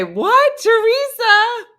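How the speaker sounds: high, loud disbelieving What; incredulous. Real, not acted. Reacting to a friend who drove two hours, in loud high disbelief. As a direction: stretch out the name in shock.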